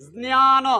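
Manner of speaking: জ্ঞান drawn out as z-nyaa-no; Sanskrit pronunciation demo. Shown as a demonstration. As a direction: sound both j and n in জ্ঞান. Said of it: "জ দুটোই উচ্চারণটা করতে হবে".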